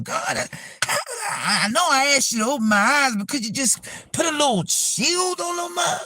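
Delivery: high, whimpering mock prayer; stammer, then beg in a high, hammed-up whine; mock tearful plea. Begging God to shield the newly opened eyes, mock-crying.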